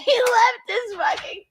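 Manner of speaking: high, breaking laughter; crying with laughter. Real, not acted.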